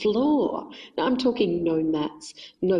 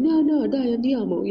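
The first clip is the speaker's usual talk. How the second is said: Italian coaxing; 6 semitones higher, 4 dB louder than usual. Retold or imitated. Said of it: "he's going".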